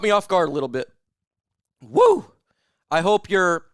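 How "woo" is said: high loud whoop; one more happy whoop. Real, not acted. Streamer still buzzing from the chug, whooping.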